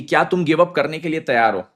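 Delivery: ordinary talk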